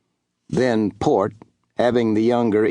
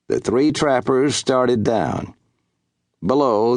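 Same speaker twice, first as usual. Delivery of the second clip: ordinary delivery, nothing marked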